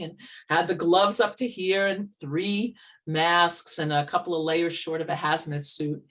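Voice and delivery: usual speaking voice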